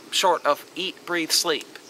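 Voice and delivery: ordinary talk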